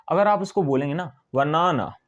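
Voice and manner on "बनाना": "बनाना" with the first ना leaned on and stretched; stressed middle syllable. Shown as a demonstration. Teacher demonstrating accent to learners, "बनाना" stressed on the middle ना. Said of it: "इस वाले हिस्से को थोड़ा सा stress".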